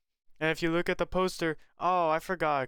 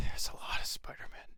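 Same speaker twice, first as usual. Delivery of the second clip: whisper; 4 dB quieter than usual. Real, not acted. Sighs then says it.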